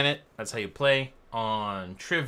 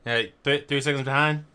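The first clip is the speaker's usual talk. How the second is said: racing radio call; 3 semitones higher, 5 dB louder than usual. Pretend.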